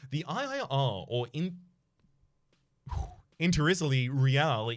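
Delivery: normal talking voice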